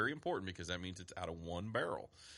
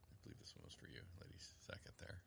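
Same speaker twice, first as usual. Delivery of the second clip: whispering; 7 semitones lower, 21 dB quieter, faster than usual. Real, not acted. Aside off mic, unvoiced.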